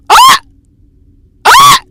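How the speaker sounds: high piercing shriek; startled scream. Real, not acted. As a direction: scream at the jump scare. Jump scare in game.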